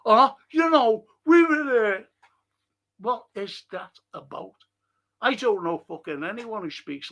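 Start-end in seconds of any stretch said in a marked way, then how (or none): 0.1-2.1 put-on high, loud sneering parody of a posh fan accent; mock posh fan accent, hammed up. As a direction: take the mick out of their fake accent, pitched way up. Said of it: "some of them put on a fucking accent".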